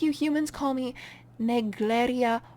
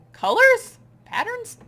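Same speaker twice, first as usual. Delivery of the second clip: bewildered; 13 semitones higher, 9 dB louder than usual. Acted. Waking up disoriented.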